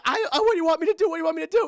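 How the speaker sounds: fast, high, loud frightened stammer; Daniel Stern, panicked. Retold or imitated. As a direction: plead in hammed-up panic. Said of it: "He's just so scared".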